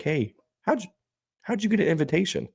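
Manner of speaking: high, puzzled caller voice; a lightly voiced surprised coworker; mock coworker voice. Retelling the call, a high puzzled coworker voice.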